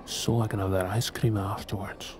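hushed aside to himself; whispering. Acted. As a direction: whisper it so she can't hear.

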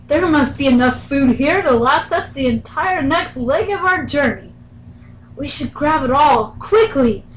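loud, urgent excited sailor; eager crewman. Acted. Crewman urging Odie to grab food, loud and eager. Crew member urges grabbing food.

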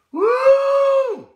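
high, loud joyful whoop; exultant woo shout. Real, not acted. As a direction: let out a triumphant whoop. Preacher exults after declaration.

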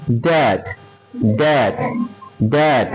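ড্যাড with an open "ae" vowel; A sounded as অ্যা. Shown as a demonstration. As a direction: model ড্যাড as a drill word. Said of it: "এর উচ্চারণ অ্যা".

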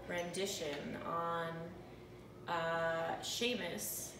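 usual speaking voice

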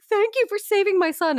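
high, gushing thanks; grateful mother. Retold or imitated. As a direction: say it in a high-pitched mock-grateful voice. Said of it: "she was like".

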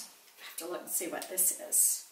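soft unvoiced whisper to herself; whispered aside. Real, not acted. Whispering to herself while checking the product name. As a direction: whisper it while reading the label. Muttering to herself mid-unboxing.

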